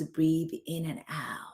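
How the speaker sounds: whispered breathing cue. Real, not acted. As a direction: breathe the words out softly, no voice. Calming cue with eyes closed.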